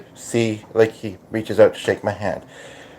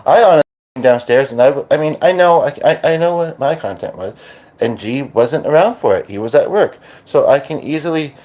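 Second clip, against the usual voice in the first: ordinary talk, nothing marked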